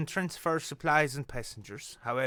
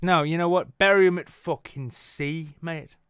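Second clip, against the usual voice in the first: louder, ranting at the idea; hammed-up angry proposal; mock outburst; 4 semitones higher, 7 dB louder than usual. Host rants to chat to bury passengers at sea, loud. Outburst about the cruise dead.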